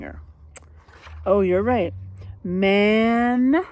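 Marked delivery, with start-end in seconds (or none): none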